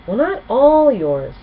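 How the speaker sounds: put-on a cheeky young dragon, slowed and punchy; Spike's teasing voice. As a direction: tease her from behind the drapes.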